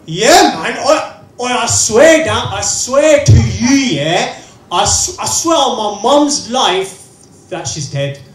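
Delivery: loud, slow, hammed-up pleading oath; desperate swearing. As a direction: beg them to believe you, dragging it out. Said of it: "friend swearing".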